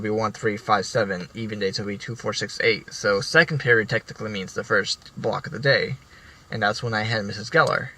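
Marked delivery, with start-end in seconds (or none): none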